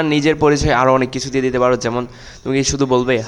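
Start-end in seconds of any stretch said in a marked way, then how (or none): none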